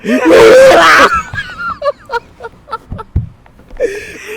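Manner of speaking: high laughing wail; reenacting the crying, breaking into laughter. Mock wail dissolving into laughter.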